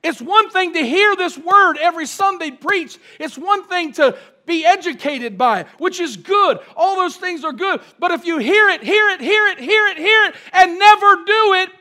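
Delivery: high, loud, building preacher's cadence; full-force preaching. Real, not acted. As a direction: hammer it out with mounting force.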